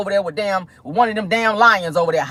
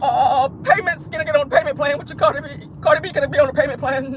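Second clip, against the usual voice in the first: high, wobbling fake blubber; 6 semitones higher than usual. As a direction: stammer and plead through hammed-up sobs. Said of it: "That was her ass on live".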